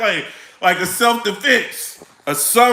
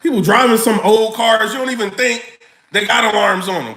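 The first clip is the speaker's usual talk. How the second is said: ordinary talk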